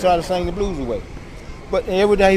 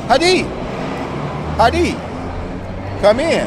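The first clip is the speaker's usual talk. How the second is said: raised, higher welcoming call; boss at the door; 5 semitones higher than usual. Acted. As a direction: call a visitor in from the doorway. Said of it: "he opened the door and says".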